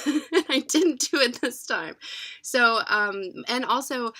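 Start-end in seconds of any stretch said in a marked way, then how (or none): 0.1-2.0 real laugh, slow and loud. Real, not acted. Reader proud she didn't cry, laughing. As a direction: laugh it out, pleased with yourself.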